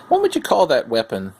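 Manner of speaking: ordinary delivery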